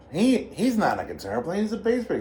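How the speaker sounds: high-pitched imitation of an objection; mock incredulous objection, pitch leaping up; protesting bandmate. Slim's acted objection to bandleader.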